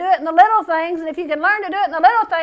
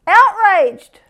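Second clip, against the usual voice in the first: loud, higher burst of outrage; outraged cry; 6 semitones higher, 8 dB louder than usual. Acted. Preacher shouts his own outrage over the booing, loud and indignant. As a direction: fling the word out indignantly.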